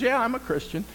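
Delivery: high, quick, delighted squeal; thrilled to meet a fellow believer; excited delight. Retold or imitated. Excited reply in joke.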